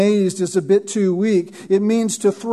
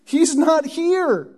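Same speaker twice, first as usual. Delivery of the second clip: higher, slow and deliberate; slow, higher-pitched angelic declaration; angel's voice; 6 semitones higher, slower than usual. Acted. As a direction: speak it slowly, like the angel correcting them. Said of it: "and the angel says".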